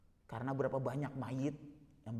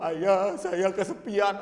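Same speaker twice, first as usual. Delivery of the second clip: louder, over-the-top wail of complaint; mock lament; 5 semitones higher, 10 dB louder, much slower than usual. Mourner complaining to dead father, mock lament. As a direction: whine it loudly, hammed up. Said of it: "malah ngeluh".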